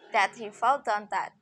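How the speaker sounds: usual speaking voice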